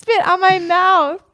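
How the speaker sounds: laughing and yelling out; laughing shout. Real, not acted. Laughing protest at spit.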